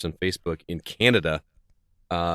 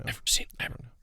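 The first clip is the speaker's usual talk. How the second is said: breathy teasing whisper into mic; giggly prank whisper; 9 dB quieter than usual. Real, not acted.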